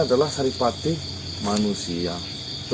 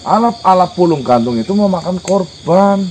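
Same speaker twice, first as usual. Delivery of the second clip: much louder and higher, emphatic; forceful insistence; 9 semitones higher, 10 dB louder than usual. Acted. Visitor warning the spirit about victims, loud and insistent. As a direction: drive the warning home at high volume.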